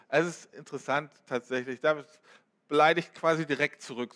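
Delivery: ordinary talk, nothing marked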